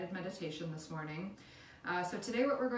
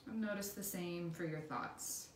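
guided meditation; 5 dB quieter than usual. Acted. Instructor leading listeners through a body scan, calm guided meditation.

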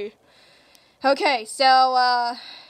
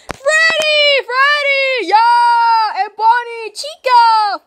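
loud, high cheering of the name; excited shout; 5 semitones higher, 10 dB louder, much slower than usual. Acted. Kid cheering the toy reveal to viewers, excited shout. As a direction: yell it out like the big reveal.

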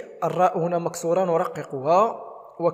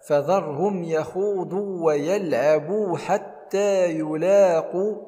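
chanted, stretched tajweed reading; slow recitation; 2 semitones higher, 3 dB louder, much slower than usual. Shown as a demonstration. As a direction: recite slowly, melodically. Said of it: "لاحظوا كيف نطقت اللام".

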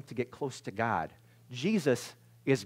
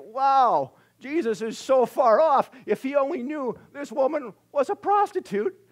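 scornful religious men; 10 semitones higher, 7 dB louder than usual. Pretend. Voicing the judging religious guys.

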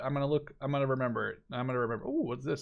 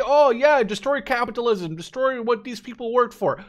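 higher, scornful mock quote of the heroes; sarcastic parody; 7 semitones higher, 5 dB louder than usual. Vlogger mocking the Star Wars heroes' speech, sarcastic. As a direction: spit out their slogan with scorn. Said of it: "And then they say".

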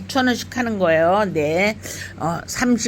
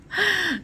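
short laugh; 12 semitones higher than usual. Real, not acted. Laughs at forgetting name.

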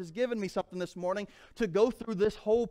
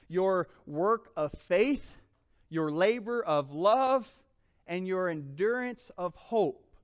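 slow, weighty emphasis; 3 dB louder, slower than usual. Acted. Preacher stresses three key words.

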